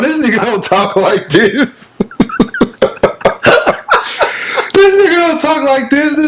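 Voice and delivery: high-pitched, laughing disbelief; cracking up. Real, not acted. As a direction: laugh through it as you tease your friend. Cracking up at friend's put-on voice.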